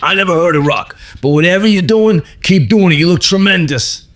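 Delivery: high, enthusiastic imitation; Steranko impression. Comic artist praising a fan's fitness, enthusiastic high voice. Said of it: "And he's like".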